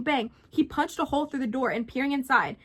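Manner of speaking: usual speaking voice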